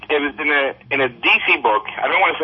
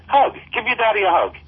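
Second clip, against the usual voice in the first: higher-pitched retold correction; quoting a hasty cover-up, pitched up; 8 semitones higher than usual. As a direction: lift the pitch on the corrected line. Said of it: "He said".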